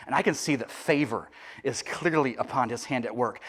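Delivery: ordinary talk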